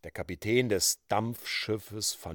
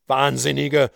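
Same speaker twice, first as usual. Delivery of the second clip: called out; 7 dB louder than usual. A narrator's reading. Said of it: "rief Dayton".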